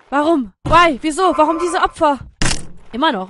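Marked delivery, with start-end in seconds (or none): 0.1-2.2 loud, repeated outcry; mock despair. Player questioning the sacrifices, loud mock despair. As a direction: wail the questions in protest.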